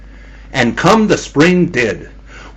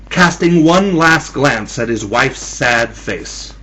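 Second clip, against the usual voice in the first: plain reading voice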